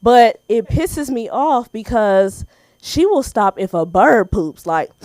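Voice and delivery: normal talking voice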